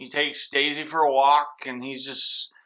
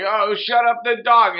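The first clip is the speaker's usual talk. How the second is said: higher, louder irritated bark; mock snap; 7 semitones higher, 5 dB louder than usual. Thug yelling at the barking puppy, mock irritated and louder. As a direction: snap at the noisy dog.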